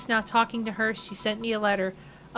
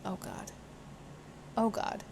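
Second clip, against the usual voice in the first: nervous mutter; 3 semitones lower, 14 dB quieter than usual. Acted. Nervous before meeting Gabby.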